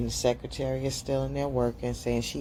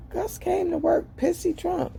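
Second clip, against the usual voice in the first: much higher, put-on indignant voice; high voice of a daughter defending herself; high mocking quote. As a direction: tattle on Gus in a high voice. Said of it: "And then Alicia's like".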